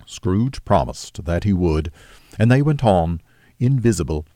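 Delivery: usual speaking voice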